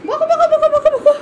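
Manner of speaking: high, rapid-fire singsong chant; playful egging-on. Real, not acted. Urging the pet to scold, playful high baby talk.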